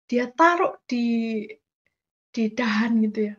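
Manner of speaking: ordinary talk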